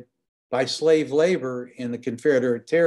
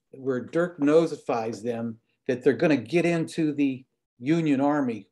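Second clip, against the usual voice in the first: ordinary delivery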